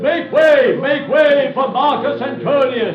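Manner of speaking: herald's call. Acted. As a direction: cry out to clear the way for the general. Herald announcing Antony's arrival.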